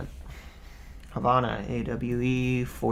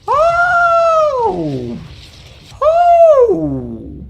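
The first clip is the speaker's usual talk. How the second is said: startled shout; 15 dB louder than usual. Real, not acted. Startled by rockets.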